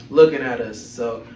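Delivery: normal talking voice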